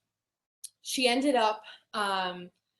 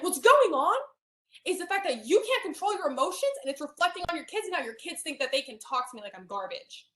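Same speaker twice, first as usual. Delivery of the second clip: snapped; 5 semitones higher than usual. Retold or imitated. Snapping at the aunt about her kids, angry and raised. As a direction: snap back hard at her. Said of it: "And I, I snapped".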